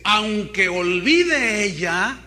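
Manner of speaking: loud, slow proclamation; emphatic scripture quote. Acted. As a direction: proclaim the verse slowly and forcefully. Cita con fuerza el versículo. (proclamación enfática, fuerte y lenta)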